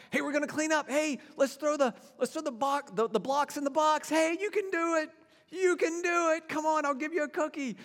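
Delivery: high, bright sing-song coaxing toddlers; coaxing little kids. Retold or imitated. Parent coaxing small kids to tidy blocks, high sing-song.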